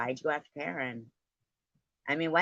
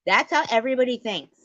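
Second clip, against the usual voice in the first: faster and much louder, climbing high on the repeat; rising emphatic shout; 11 semitones higher, 9 dB louder, faster than usual. Real, not acted. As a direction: hammer it home with growing volume. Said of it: "repeating it louder, emphatic".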